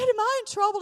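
high, loud, panicky retold question; alarmed question. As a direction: squeak it out in mock alarm. Retells her alarmed question to officer.

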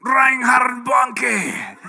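drawn out, shouted grand name; grand evangelist name. Retold or imitated. Preacher mocking himself beside a famous evangelist, slow shout. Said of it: "I'm not, you know".

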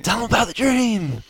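high, loud insistent push. Retold or imitated. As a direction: press him harder to tell it. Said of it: "the lady ... saying".

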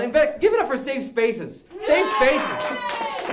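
rally the room with each slogan; rallying catchphrases. Acted. Host pumps up the audience with his catchphrases, rallying call.

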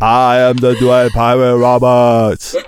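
slow, loud giant's rumbling line; Andre the Giant. Retold or imitated. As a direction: boom it out slowly like a gentle giant. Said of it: "Andre the Giant in Princess Bride".

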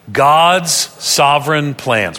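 slow, evenly spaced words for group reading; read aloud together. Shown as a demonstration. Preacher leading the congregation in reading aloud, slow and deliberate. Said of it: "read that out loud with me together".

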